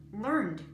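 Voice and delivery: "learned" ending in a voiced D; pronounced learned. Shown as a demonstration. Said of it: "It's pronounced learned".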